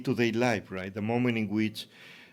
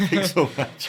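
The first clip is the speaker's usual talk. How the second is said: laughing through a bright thank-you; 8 semitones higher, 5 dB louder than usual. Real, not acted. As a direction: thank him while still laughing. Laughing thanks after interview.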